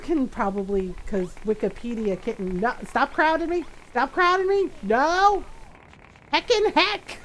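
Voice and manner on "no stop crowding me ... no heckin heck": high, playful protest; mock exasperation. To characters crowding her in game, mock exasperated. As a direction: push them away with a raised, squeaked complaint.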